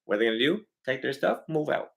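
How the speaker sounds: usual speaking voice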